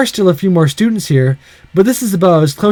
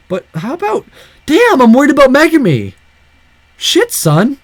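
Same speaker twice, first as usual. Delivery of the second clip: worried outburst; 9 semitones higher than usual. Real, not acted. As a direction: blurt out anxious concern.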